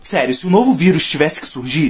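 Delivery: ordinary delivery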